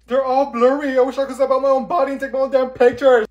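high, loud complaint; loud frustrated rant about the photos; frustrated yell. Acted. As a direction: vent at the bad pictures.

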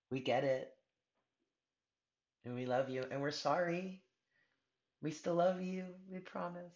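soft, lifted, loving apology; softly forgiving; tender reassurance. Acted. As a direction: promise it gently, voice high and hushed. Voicing loving apology to the listener.

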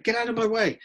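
fast, high-pitched mock outburst; mock exasperation. Lecturer fed up with one beggar after another, exasperated. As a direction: lose patience suddenly, pitch leaping up.

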